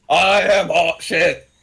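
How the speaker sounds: high, drawn-out mock yell. Player yelling back while eavesdropping on the pilot, mock yell. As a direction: yell it slowly, every word stretched. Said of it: "That was yelled".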